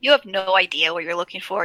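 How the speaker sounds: ordinary delivery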